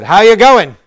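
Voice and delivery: very loud, high-pitched Australian greeting; boisterous Australian. Retold or imitated. Australian seatmate greeting him on a plane, boisterous Australian accent. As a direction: boom it out in a mock Australian accent. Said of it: "this boisterous Australian sat down".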